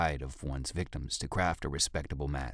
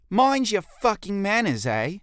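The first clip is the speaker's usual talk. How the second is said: much higher, much louder snarling threat; menacing threat; 10 dB louder than usual. Acted. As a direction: grip his hair and threaten him hard. Jolie chokes guard, threatens.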